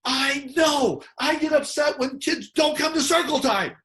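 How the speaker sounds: put-on high, loud matching the kid's anger; mock angry teacher yelling back; teacher shouting along. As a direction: get as upset as the kid, loudly. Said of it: "And the teacher goes".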